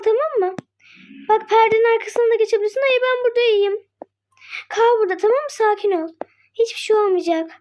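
ordinary talk

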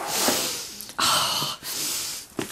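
long, heavy breath out; emotional sigh. Real, not acted. Crafter too emotional over the gift, long composing sigh. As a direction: sigh to collect yourself.

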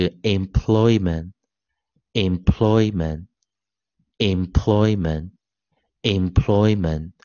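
"employment" drawn out very slowly, syllable by syllable; pronunciation model. Shown as a demonstration. Teacher modelling the three-syllable word for students, slow careful pronunciation. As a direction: repeat "employment" slowly and evenly, ending in "ment". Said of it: "它通常都是念ment".

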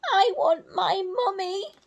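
very high tiny owl wail; littlest owl. Acted. Reading to children, baby owl Bill wails for mummy. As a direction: cry for mummy like the smallest baby. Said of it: "said Bill".

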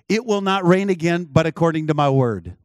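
loud, emphatic pronouncement; forceful quoted decree; retold prophetic declaration. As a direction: proclaim it like a prophet, pushed loud.

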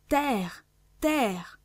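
cri bref, un peu plus aigu; voix forte. A narrator's reading. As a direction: annoncer la terre d'une voix forte. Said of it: "une voix forte se fit entendre". (exclamation forte de vigie, modérément criée)

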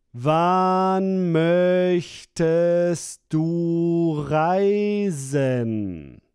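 usual speaking voice

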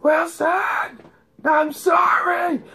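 high, loud, drawn-out wail; anguished movie shout. Acted. Tom Hanks on the raft losing his volleyball, anguished shout. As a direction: cry out for a lost friend, building to a scream. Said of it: "and he's like".

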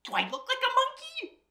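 high-pitched, incredulous question; indignant protest. Acted. Reader denying to children she's a monkey, indignant protest. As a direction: squeak it out in mock indignation.

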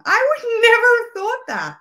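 high, loud burst of disbelief; astonished. Real, not acted. Host can't believe her friend is Mexican, astonished.

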